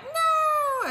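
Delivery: very high, loud, drawn-out no; grumpy refusal. Acted. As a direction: squeal out a protesting no. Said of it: "he's all like".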